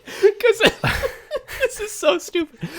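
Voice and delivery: high-pitched laughing through words; cracking up while talking. Real, not acted. Laughing at the premise.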